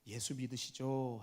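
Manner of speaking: lightly voiced deferential servant, low and slow; a hint of a humble servant, drawn out; servant addressing master. Groom inviting his royal master to believe, lightly deferential. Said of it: "왕손한테 가다가 얘기합니다".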